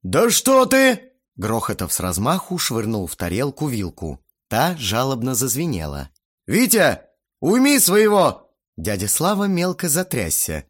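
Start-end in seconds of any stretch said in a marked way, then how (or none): 0.0-1.1 slow, loud, high outburst; enraged shout. A narrator's reading. Guest explodes at the boy's needling, enraged shout. 6.5-8.5 loud, high-pitched appeal; angry demand. A narrator's reading. Guest orders father to control his son, angry.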